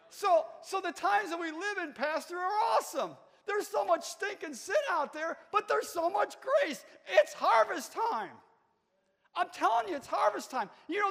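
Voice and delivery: high, loud shouted revival preaching; preaching at full force. Acted. Preacher firing up the church about grace, shouting. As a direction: preach it like harvest time is here.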